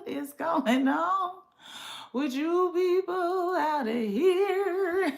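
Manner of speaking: half-laughing fake sob; mock crying. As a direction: drag it out like a sob.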